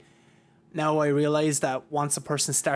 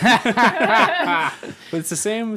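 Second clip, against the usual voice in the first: words stretched out on a loud laugh, higher; laughing through words; 7 semitones higher, 8 dB louder, much slower than usual. Real, not acted. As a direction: laugh your way through it, slowly. Laughing while starting a point.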